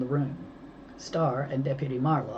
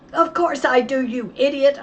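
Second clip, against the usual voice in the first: much higher and louder yell; shout; 11 semitones higher, 9 dB louder than usual. A narrator's reading. Mo shouting at the kidnapper. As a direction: scream it at him. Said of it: "I shouted".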